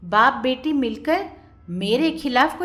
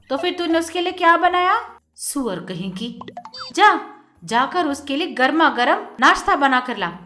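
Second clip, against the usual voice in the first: scolding stepmother; 5 semitones higher, 6 dB louder than usual. A narrator's reading.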